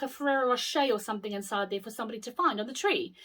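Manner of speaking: ordinary delivery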